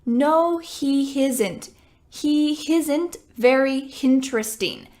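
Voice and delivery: isn't said as hisn't with an added H; added H error. Shown as a demonstration. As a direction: mimic the Italian learner adding H. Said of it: "Adding an H".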